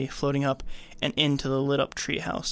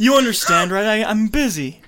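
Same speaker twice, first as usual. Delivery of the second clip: mock excuse; 7 semitones higher, 7 dB louder than usual. Playing the demon's excuse.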